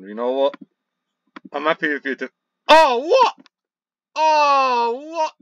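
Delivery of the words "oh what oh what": high, loud squawk of shock; shocked disbelief. Real, not acted. Gamer stunned by the play, shocked outcry. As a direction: cry out high and stretched, stunned.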